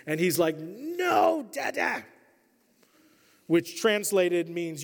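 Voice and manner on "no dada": put-on toddler's wail. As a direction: wail like a hurt one-year-old. Crying toddler protests being knocked over.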